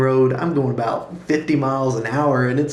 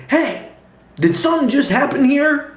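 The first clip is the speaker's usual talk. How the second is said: calling out from the car; 12 semitones higher than usual. Retold or imitated.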